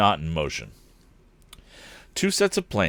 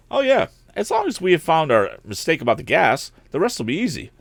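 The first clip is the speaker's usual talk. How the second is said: lightly voiced young man, higher; a bright, cheerful lad, pitched up; higher young hero voice, eager; eager young inventor; 8 semitones higher than usual. A narrator's reading. Narrator voices young Tom.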